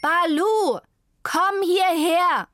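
loud high slow call. A narrator's reading. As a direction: call the dog home from afar. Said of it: "rief die Frau".

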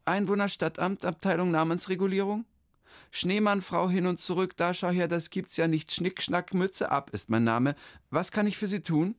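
high, chirpy clerk voice; the clerk rattles off her greeting, high; official lady. Acted. The town-hall clerk greets Hermann, high and chirpy.

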